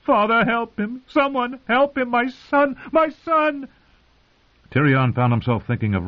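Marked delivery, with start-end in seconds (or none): 0.0-3.7 high, frantic pleading; wailing. A narrator's reading. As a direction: beg in anguish for her son. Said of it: "Cersei wailed".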